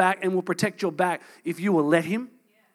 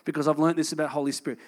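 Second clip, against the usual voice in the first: normal talking voice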